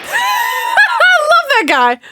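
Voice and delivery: high, slow laugh-shout; squealing through laughter. Real, not acted. Laughing fondly at character.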